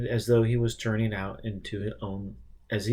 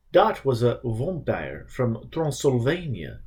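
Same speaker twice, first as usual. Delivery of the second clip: lightly put-on Transylvanian accent, slowed; thick vampire accent; slower than usual. Donald explaining to Richard in a thick vampire accent. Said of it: "in words thicker than the creature's own".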